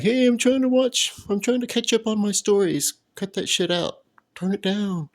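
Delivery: high-pitched mock complaint at noisy neighbors. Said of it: "I'm all like".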